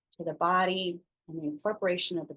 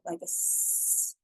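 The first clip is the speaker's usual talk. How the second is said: snake hiss; 5 dB quieter, slower than usual. Shown as a demonstration. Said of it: "a snake's hiss".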